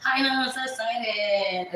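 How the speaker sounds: mocking the friend's excited bragging; Amanda gushing. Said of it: "She'll be like".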